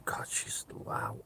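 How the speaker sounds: hushed, whispered admiration; awed whisper. Retold or imitated. Catfish admiring the pizza place girl, awed whisper. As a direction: breathe it out in awe, barely voiced.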